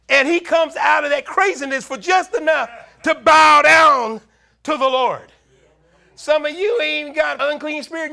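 high, loud, driving sermon delivery; full-force preaching. Real, not acted. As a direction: preach it hard to the congregation.

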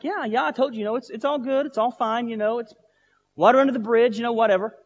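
high-pitched, hurried reassurance to end it; dismissive brush-off. Retold or imitated. Other pastor waves off a repeat apology, dismissive.

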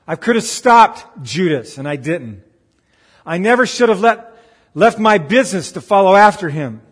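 loud, dramatic second-guessing of your own choices; anguished self-reproach. Acted. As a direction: berate yourself as the panicked disciple. Voicing Thomas's Saturday regrets.

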